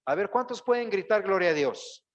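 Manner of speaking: loud, high preacher's call to the congregation; rousing call. Shown as a demonstration. Preacher rousing the congregation to shout, in a loud, high call. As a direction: fire up the crowd. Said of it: "tiene que salir de mi corazón algunas veces la fuerza".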